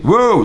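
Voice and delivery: high, loud wordless "wow"; mute person's cry. Shown as a demonstration. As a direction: voice swooping up in a wordless call. Imitates mute person making sounds.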